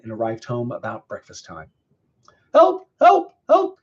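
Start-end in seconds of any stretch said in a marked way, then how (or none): 2.5-3.8 high, loud, short cries; frantic high-pitched yelps for help; crying for help. A narrator's reading. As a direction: call out sharply for rescue. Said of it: "he cried outside the back door".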